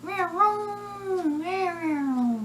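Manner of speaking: high, drawn-out meowing cry; cartoon creature wail; Shy Guy. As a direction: let a long high meow slide downward. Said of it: "making the Shy Guy voices".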